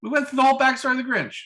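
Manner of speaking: high, fast, incredulous objection; indignant protest. Real, not acted. Host disputes co-host about the Grinch, indignant protest.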